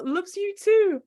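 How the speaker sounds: ordinary delivery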